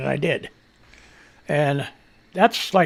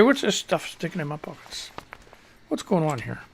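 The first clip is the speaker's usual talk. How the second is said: much softer, half under his breath; muttering to himself; 3 semitones lower, 12 dB quieter than usual. Real, not acted. Finding junk in his pockets, mumbling much softer. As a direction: mumble it to yourself.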